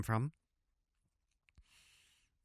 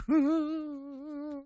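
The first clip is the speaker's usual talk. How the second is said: high laugh; 4 dB quieter than usual. Acted. Laughing in the gaucho voice.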